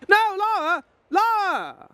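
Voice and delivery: loud, high cry of her name; dismayed shout. Real, not acted. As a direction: shout as she dies. Lara dies in game.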